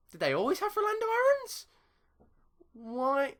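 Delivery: can't believe what you're seeing; surprised. Real, not acted. Surprised by opponent's new signing.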